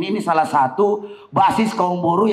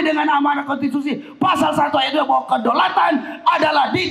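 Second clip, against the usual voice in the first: fiery rally speech; 7 semitones higher than usual. Acted.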